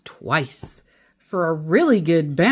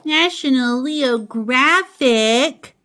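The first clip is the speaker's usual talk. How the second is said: musing; 6 semitones higher, 4 dB louder, slower than usual. A narrator's reading. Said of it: "mused Mama".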